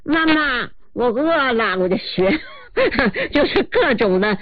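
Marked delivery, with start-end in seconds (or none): 0.0-1.8 高而稚嫩的童声; 像饿了的小孩喊妈妈; 小孩儿声音. Shown as a demonstration. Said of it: "那小孩儿呢". (学小孩撒娇喊饿)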